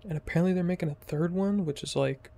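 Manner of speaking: ordinary delivery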